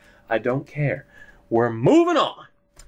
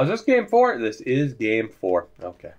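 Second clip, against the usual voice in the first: usual speaking voice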